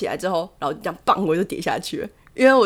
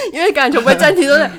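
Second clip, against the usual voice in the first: said through laughter, higher and louder; laughing; 6 semitones higher, 7 dB louder, faster than usual. Real, not acted. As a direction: laugh while you explain it. Laughs while explaining rugby. (边笑边说)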